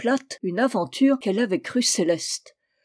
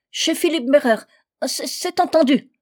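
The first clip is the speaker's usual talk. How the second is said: higher, halting eager promise; desperate agreement; 6 semitones higher, 4 dB louder than usual. A narrator's reading. Trapped man agrees to Fanta's sculptor, desperately. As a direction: stumble as you hastily consent.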